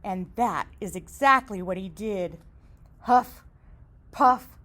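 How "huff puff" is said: huff and puff. Acted. Wolf blowing the house.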